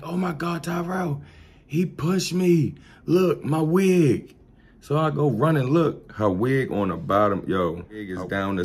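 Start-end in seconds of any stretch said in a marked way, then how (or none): 0.0-4.3 high, slow, whiny mother; high, drawn-out distressed mother; upset mother. Retold or imitated. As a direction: plead like a wronged mother.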